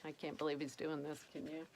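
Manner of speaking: quiet aside. Real, not acted.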